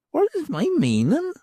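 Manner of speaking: put-on a knowing old beldam; old hag's voice. Hag repeats.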